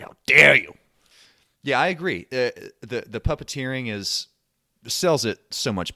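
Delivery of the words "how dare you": loud, raised, hammed-up indignation; mock outrage. Offended Italian listeners protest, in loud mock outrage. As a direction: burst out as if grandly offended. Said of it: "people from Italy listening to this is like".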